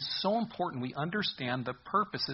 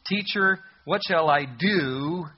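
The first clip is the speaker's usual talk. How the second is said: higher, louder, slow testing question; lawyer's question; 3 semitones higher, 7 dB louder, much slower than usual. Acted. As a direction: draw out the question, testing Jesus. Lawyer's quoted question to Jesus.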